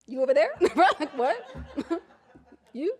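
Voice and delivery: high, incredulous double take with a laugh; surprised disbelief. Retold or imitated. Nurse startled when the patient wants someone else, high and surprised. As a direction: point and ask you, me, in disbelief. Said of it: "and I said".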